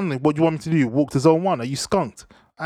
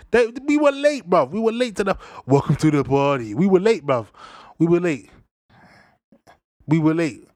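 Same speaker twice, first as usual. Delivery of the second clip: exasperated rant; 3 semitones higher than usual. Real, not acted. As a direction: hammer it home, exasperated. Ranting about UK being late.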